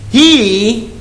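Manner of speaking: "He," set apart and drawn out; single out "He," then pause; stress on He. Shown as a demonstration. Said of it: "I wanna emphasize that word".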